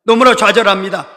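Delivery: normal talking voice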